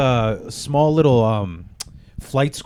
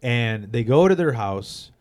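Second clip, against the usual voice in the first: usual speaking voice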